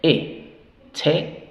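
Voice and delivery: "E" as short English "eh", then "che" for "te"; stressed short E in "E, che"; short E sound. Shown as a demonstration. Teacher answers the vowel question, "E, che" with short "eh". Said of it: "El sonido corto de la E".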